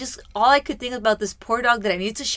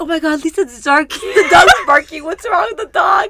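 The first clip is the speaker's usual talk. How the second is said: panicked quote; 6 semitones higher, 10 dB louder than usual. Retold or imitated. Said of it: "And I'm like".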